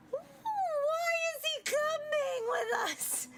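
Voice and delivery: very high, slow, whining; whiny character voice. Acted. Companion wails about the kidnapper tagging along, slow high whine. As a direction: drag it out like a wailing complaint.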